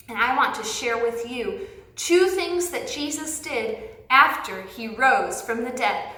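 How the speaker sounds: ordinary delivery, nothing marked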